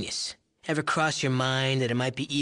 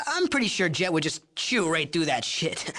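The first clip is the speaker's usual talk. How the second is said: ordinary delivery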